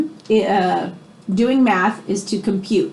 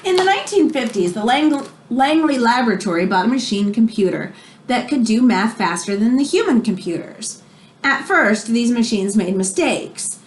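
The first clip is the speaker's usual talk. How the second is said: expressive read-aloud storybook voice; reading to children; 3 semitones higher than usual. Acted. Teacher reading a biography to her class, expressive read-aloud. Reads picture book to class.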